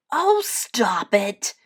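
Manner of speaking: lightly acted, drawn-out grumble; grumbling. A narrator's reading. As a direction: complain like a fed-up little bear. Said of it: "grumbled Little Bear".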